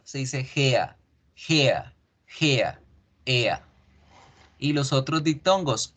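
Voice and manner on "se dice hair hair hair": hair said as hea, ea glide, no r; teacher drilling hair; English hair. Shown as a demonstration. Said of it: "cabello no se dice hair".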